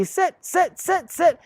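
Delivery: high sing-song mock owner chanting sit; owner repeating commands. Owner nags the puppy to sit, high sing-song mockery. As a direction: imitate a clueless owner nagging the puppy. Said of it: "they tell the puppy".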